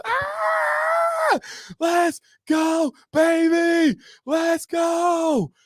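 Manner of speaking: a host hyping himself after a rap, shouting; hyped shout. Real, not acted. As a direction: celebrate like you just won.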